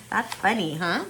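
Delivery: ordinary delivery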